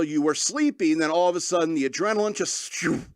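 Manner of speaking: ordinary talk, nothing marked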